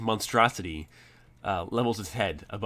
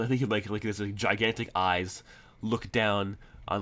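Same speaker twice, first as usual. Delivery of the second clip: ordinary talk, nothing marked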